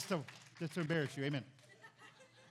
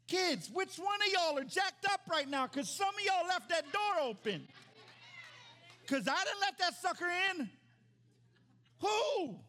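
preaching shout; 14 semitones higher, 8 dB louder than usual. Real, not acted. Pastor calling out the kids in church, high loud shout. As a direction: call them out, louder and louder.

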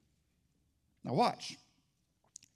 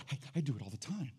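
mock defensive murmur; 6 semitones lower, 10 dB quieter, faster than usual. Church people quoted.

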